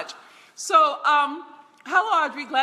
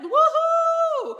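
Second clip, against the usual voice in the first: high, thrilled cheer for a newcomer; excited woohoo; 14 semitones higher, 4 dB louder than usual. Real, not acted. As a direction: whoop it out with joy. Cheering a first-time viewer.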